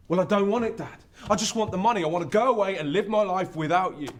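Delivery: loud, high, heated outburst; angry rejection. Acted. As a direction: throw it hard in dad's face. Son rejects home, quarrels with dad.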